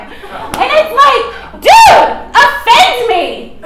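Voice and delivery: high, slow, drawn-out "dude, offend me"; defiant dare. Real, not acted. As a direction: dare them to offend you. Provocative dare.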